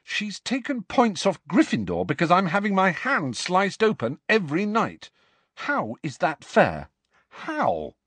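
high, aggrieved outburst; fuming. A narrator's reading. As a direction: rant at the unfairness, slowing at the end.

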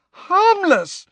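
very high, loud shriek of disbelief; shrill, outraged outburst. A narrator's reading. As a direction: shriek it back incredulously. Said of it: "said Hermione shrilly".

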